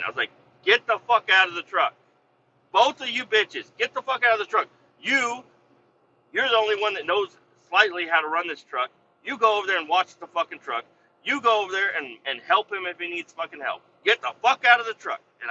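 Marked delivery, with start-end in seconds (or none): 0.6-1.9 higher, forceful angry command; retold mad order. Boss throwing two workers out of the truck, angry. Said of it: "I got mad". 2.7-15.2 high-pitched angry tirade; retold mad orders. Boss ordering both workers about after kicking them out, angry. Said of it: "I got mad".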